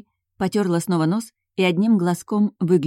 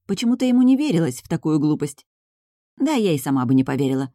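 ordinary delivery, nothing marked

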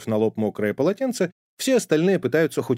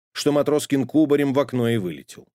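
usual speaking voice

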